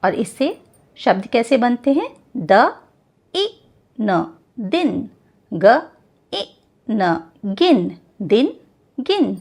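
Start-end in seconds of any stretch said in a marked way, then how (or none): 2.4-4.4 द, ई, न sounded out one by one, slowly. Shown as a demonstration. Teacher to children, sounding out slowly. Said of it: "इसको हम बोलते हैं ई". 5.5-7.3 ग, ई, न sounded out one by one, slowly. Shown as a demonstration. Teacher to children, sounding out slowly. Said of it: "इसको हम बोलते हैं ई".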